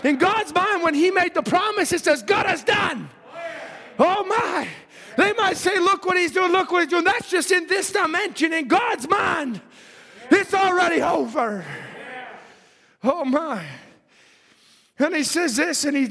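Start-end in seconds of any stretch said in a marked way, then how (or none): 0.0-11.6 high, shouted preaching; impassioned pulpit cry; full-force preaching. Real, not acted. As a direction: drive it home to the congregation at a shout.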